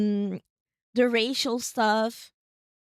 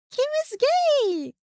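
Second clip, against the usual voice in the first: much higher, louder and slow, a delighted shriek; excited squeal; 6 dB louder, slower than usual. Real, not acted. Thrilled reveal about game character.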